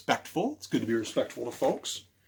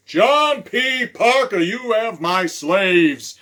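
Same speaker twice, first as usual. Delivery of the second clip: loud, high, slow demand of a harsh slave master; menacing slave master; 6 semitones higher, 8 dB louder, slower than usual. Acted. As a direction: bellow it as the cruel master at the door. Slave master confronts John Parker.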